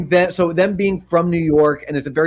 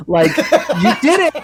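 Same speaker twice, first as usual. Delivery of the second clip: much higher, slower, with a laugh; quoting Mona Lisa; 10 semitones higher, slower than usual. Retold or imitated. Praising Vinny after the hunting trip, sweet high cheer. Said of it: "And she's like".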